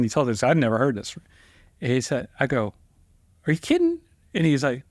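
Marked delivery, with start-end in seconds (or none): 3.5-4.0 much higher, incredulous squeak; can't believe it's real; high squeaky disbelief. Retold or imitated. Disbelief at formal phrase.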